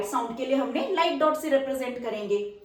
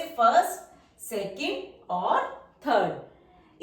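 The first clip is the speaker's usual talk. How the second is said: slow counting; much slower than usual. Shown as a demonstration. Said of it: "counting places slowly".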